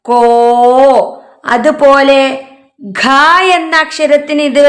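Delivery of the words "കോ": കോ stretched very long and clear; a teacher drawing out കോ; slow model answer. Shown as a demonstration. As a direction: hold കോ out for the class to repeat. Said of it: "എന്താണ്?".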